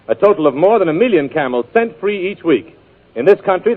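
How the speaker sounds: ordinary talk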